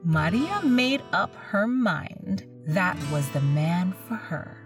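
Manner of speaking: ordinary delivery, nothing marked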